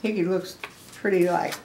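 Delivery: ordinary delivery, nothing marked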